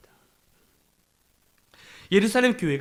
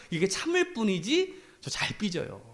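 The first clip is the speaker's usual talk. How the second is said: ordinary talk, nothing marked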